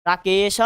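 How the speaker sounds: राकेश said as "raakeshah"; राकेश ending on a breath of h; visarga pronunciation. Shown as a demonstration. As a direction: add a final "ah" to राकेश. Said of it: "ऐसे करके आ जाता है".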